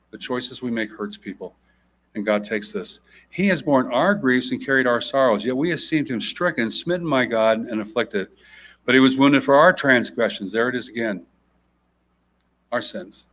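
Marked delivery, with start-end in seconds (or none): 3.3-10.5 measured formal Bible reading; scripture reading. Acted. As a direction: read the passage with weight. Reads Isaiah aloud.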